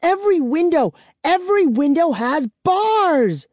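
high, loud despairing wail. Acted. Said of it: "she wailed".

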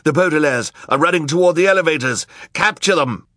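high, loud, hurried cry; alarmed crowd shout. A narrator's reading. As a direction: raise the alarm fast. Crowd spots the fleeing children.

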